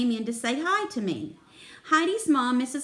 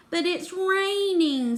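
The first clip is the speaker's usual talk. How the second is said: slow, higher-pitched groan of protest; groaning whine; 7 semitones higher, slower than usual. A narrator's reading. Simon objects to working in the rain, groaning. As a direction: whine it, dragging out the words. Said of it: "Simon groaned".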